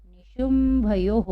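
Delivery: निशुंभयोः said slowly with the visarga as हो; निशुंभयोः ending in हो; model reading. Shown as a demonstration. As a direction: stretch निशुंभयोः out for the class. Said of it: "और हम पढ़ेंगे".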